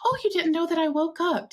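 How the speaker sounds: imitated mother, high and lilting; voicing her mom. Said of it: "And she's like".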